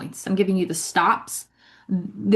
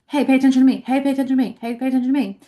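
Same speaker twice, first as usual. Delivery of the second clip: higher, insistent mimicked pestering; mock needy plea, pitched up; mock attention-seeking; 5 semitones higher, 4 dB louder, much faster than usual. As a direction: pester for attention, the same singsong each time. Mimics someone begging for attention.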